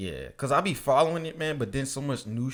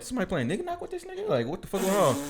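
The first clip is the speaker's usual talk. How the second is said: high-pitched, fast, baffled; confused disbelief; 8 semitones higher, faster than usual. Retold or imitated. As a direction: squeak it out in disbelief. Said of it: "I was like".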